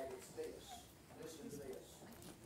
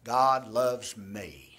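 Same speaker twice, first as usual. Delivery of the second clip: God loves me, slowly word by word; slow, spelled out; 3 semitones lower, 4 dB louder, much slower than usual. Shown as a demonstration. Pastor leading the congregation to repeat, slowly. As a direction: lay down each word slowly for them to echo. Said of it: "Say it with me".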